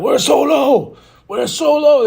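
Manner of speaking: loud, high yelling search call; mock hunting yell. Tama and Jacob hunting for Solo, loud high yell. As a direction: call out for him like you're on the hunt.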